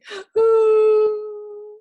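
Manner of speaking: a high, loud pretend sob-gasp; hammed-up crying gasp; mock crying gasp. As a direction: fake a little crying gasp for the child. Child asked to cry tears.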